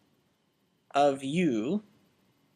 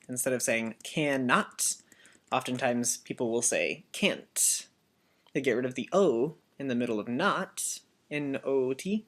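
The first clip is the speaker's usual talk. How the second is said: normal talking voice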